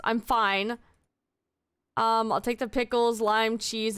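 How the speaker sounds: usual speaking voice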